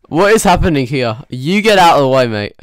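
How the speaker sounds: annoyed shout at a blocking driver; loud yelling. Real, not acted. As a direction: raise your voice, get out of my way. Yelling at traffic blocking the bus.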